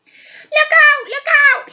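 high loud frightened call; panicked cry. Acted. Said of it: "he cried".

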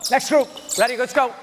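usual speaking voice

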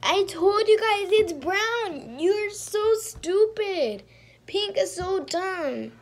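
high, loud, slow scolding of the team; loud exasperated rant at her crewmates; frustrated yelling. Real, not acted. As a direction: tell them off, dragging each word. Yelling at teammates after being voted.

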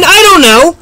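high startled outburst; surprised yell. Real, not acted. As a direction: blurt it out high and loud. Startled by unexpected event.

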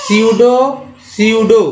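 स्यूडो said starting with s, no p; स्यूडो, sounded "syoodo"; pronunciation demo. Shown as a demonstration. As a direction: model स्यूडो clearly for the class, p silent. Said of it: "सिर्फ एस से उच्चारण करेंगे".

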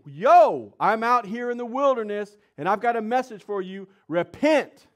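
loud, high preacher's shout; calling out. Retold or imitated. John the Baptist calls from the wilderness, shouted proclamation. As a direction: proclaim it to a crowd, punching the last word.

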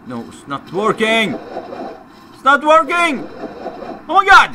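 building to a high, loud shout; exasperated outburst. Real, not acted. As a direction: yell in real frustration at failing. Repeated failure in the game.

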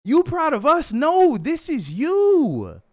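high, loud question, then slow insistence; emphatic protest. Retold or imitated. Son turning Mom's pride back on her, emphatic protest. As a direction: throw the praise back, high and drawn out.

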